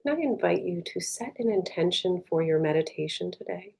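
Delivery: soft, unhurried meditation voice with long pauses; guided meditation. Real, not acted. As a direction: guide them gently inward, leaving space between phrases.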